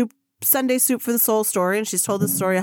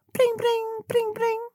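much higher sing-song mock phone ring; phone ring imitation; 13 semitones higher, slower than usual. The phone at fat camp rings again, high mock ringtone. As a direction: chirp it like a ringing phone.

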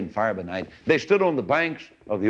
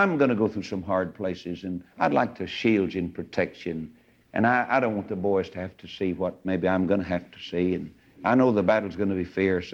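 tender husband; 4 semitones lower than usual. Retold or imitated. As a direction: gently reason with her, voice sinking low. Retells husband gently sending wife back.

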